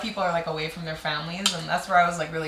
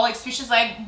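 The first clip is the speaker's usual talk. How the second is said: ordinary delivery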